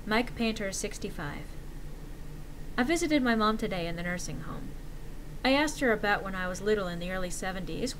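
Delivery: ordinary delivery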